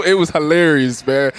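ordinary talk

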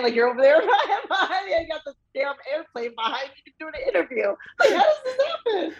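high-pitched, talking through laughter; laughing. Real, not acted. Fan marvels at interview by the airplane, laughing. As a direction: crack up while acting out the absurd complaint.

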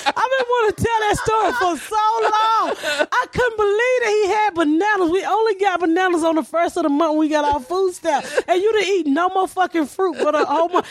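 high, laughing storytelling. Real, not acted. Comic telling the story to friends, laughing through it. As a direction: tell it while cracking up.

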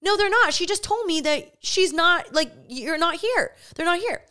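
high, exasperated retold protest; annoyed insistence. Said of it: "So I'm getting annoyed".

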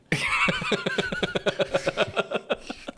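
high squealing laugh; falsetto laugh. Real, not acted.

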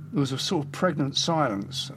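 ordinary talk

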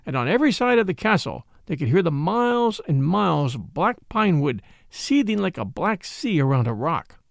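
plain reading voice

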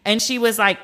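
ordinary delivery

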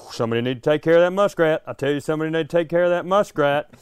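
hillbilly voicemail demanding the muskrat be removed, fast and heated; hillbilly rant. Retold or imitated. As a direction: spit it out quick like an unhinged Southern caller. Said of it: "unraveling, like, hillbilly".

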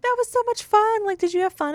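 high-pitched, mock-delighted mom; gushing mom impression. Retells oblivious mom's delight before fight.

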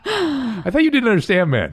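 high, laughing tease; laughing while talking. Real, not acted. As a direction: laugh the words out at your friend. Teasing co-host, laughs through it.

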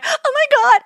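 high, loud retold freak-out; panicked outburst. Traveler at the freaking kiosk, panicked. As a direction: relive the moment of panic at full pitch.

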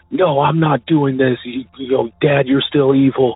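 high, contained imitation of screaming; retold screaming. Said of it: "he's screaming".

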